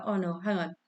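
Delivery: normal talking voice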